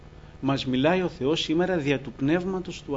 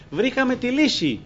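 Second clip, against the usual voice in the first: higher, louder, mock eureka; excited exclamation; 9 semitones higher, 4 dB louder than usual. Mimicking sailors who think they found the answer, excited. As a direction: imitate a sudden excited discovery. Mimicking people's eager relief.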